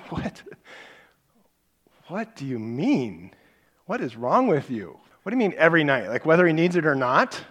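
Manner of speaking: rising from puzzled to loud and high; retold shock that builds to a loud, high protest; shocked disbelief. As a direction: pile on the outrage, question by question. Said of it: "I was a little shocked by that".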